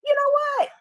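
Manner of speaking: high, gleeful gloat; high mock curse at the critics. Real, not acted. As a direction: relish each word like a taunt. Gloating over the losers.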